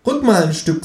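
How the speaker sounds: high, cheeky kangaroo voice; high, sulky kangaroo. Acted. Kangaroo's voice in the story.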